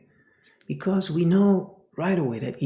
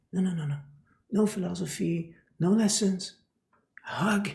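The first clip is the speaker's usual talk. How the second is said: ordinary talk, nothing marked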